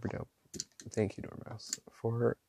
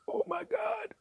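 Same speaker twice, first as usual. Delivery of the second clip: squeaky excitement; 6 dB quieter than usual. Real, not acted. Thrilled as the ink dries.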